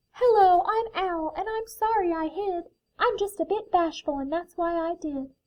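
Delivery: high-pitched little owl voice; bashful owl. A narrator's reading. Owl saying sorry to new friends, bashful high voice.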